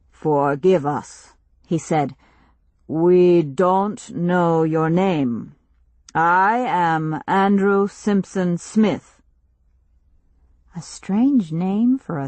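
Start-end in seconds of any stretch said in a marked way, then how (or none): 0.1-1.3 slow, grave stranger voice; slow solemn chief. Acted. 2.9-9.2 slow, grave stranger voice; slow solemn chief. Acted.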